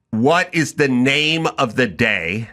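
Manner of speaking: spelling it out slowly for Mike; each word laid out like to a child; slow, deliberate question. Retold or imitated. Husband slowly spelling out his question to Mike. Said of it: "as I said to him yesterday".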